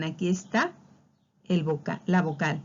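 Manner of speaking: ordinary talk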